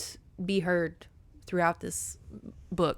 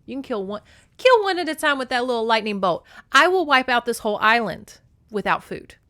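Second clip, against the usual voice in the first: mock menacing goddess, louder and higher; lightly voiced threat; 5 semitones higher, 8 dB louder than usual. Demeter threatens to wipe out an island, menacing. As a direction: make a loud boast of a threat.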